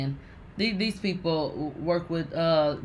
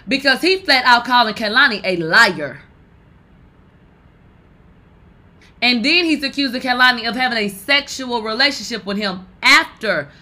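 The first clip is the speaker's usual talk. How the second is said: exasperated rant; 5 semitones higher, 7 dB louder than usual. Real, not acted. Host demanding the friend come forward, loud and fed up. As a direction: hammer every question home, fed up. Host ranting about the accusation.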